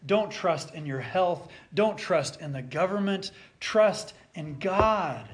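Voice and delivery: loud, slow, building sermon voice; fervent preaching. Acted. Preacher driving home Peter's reasoning, loud and slow. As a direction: preach it out with fire.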